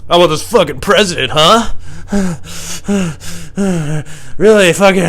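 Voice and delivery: high, loud, strained talk between panting; hammed-up grunting chat mid-sex; talking during sex. Podcaster discussing the president while having sex, hammed-up panting. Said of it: "talk about shit while you're having sex".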